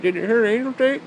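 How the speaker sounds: a goofy high friend voice; imitating his friend. Friend asking again about the tape, goofy voice. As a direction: ask it like an eager pest. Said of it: "He's like".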